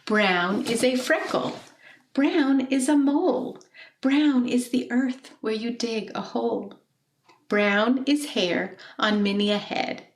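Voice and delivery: gently expressive poem recital; poem reading. Acted. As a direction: read the poem to the class, savouring each line. Teacher reads poem aloud.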